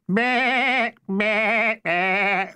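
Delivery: high bleating like sheep. Acted. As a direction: bleat like a flock. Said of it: "outbreaks of bleating".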